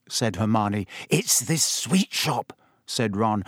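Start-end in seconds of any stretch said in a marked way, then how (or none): 1.1-2.5 slow, far-away dreamy tone. Acted. Ron dreamily describing the sweet shop to Hermione, slow. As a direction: drift off thinking of sweets, slowly. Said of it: "a dreamy look coming over his face".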